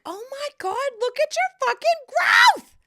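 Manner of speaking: high, loud shout of pride; screaming self-congratulation; excited shout. Retold or imitated. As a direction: yell it out bursting with pride. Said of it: "I just was like".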